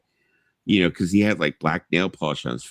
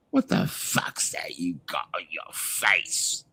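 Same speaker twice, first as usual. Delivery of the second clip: British thug going from slow and hushed to loud; British accent; 2 semitones higher, 3 dB louder, slower than usual. Retold or imitated. Factory folk threatening a returning rock star, British accent building to a shout. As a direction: start with a hushed British menace, then blow up loud. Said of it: "You're doing a very good British accent".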